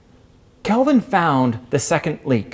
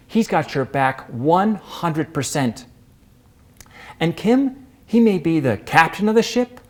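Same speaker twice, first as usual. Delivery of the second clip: normal talking voice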